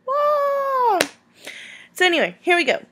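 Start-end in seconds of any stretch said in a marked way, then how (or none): 0.1-1.0 put-on high, loud, wailing little-kid voice; hammed-up toddler sob ending in a wail; mock crying toddler. Mom acting out her son's tearful complaint, high mock wail. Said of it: "And I'll be like".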